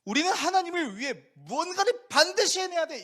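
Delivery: loud, high, pushed declaration; zealous vow. Pretend. Preacher mocking a striving believer, loud and high. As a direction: proclaim it like a forced oath.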